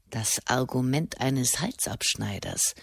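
put-on low, soft growl of the old monk; a hint of a surly hermit. A narrator's reading. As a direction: growl it with contempt. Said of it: "knurrte William Fleet".

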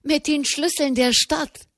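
high, rising, eager repeat; excited. A narrator's reading. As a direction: press the news on, thrilled. Breathless prelate reports news.

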